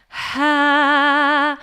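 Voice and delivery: airy h before the note; breathy onset. Shown as a demonstration. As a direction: let the breath leak in first. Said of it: "start singing with breath".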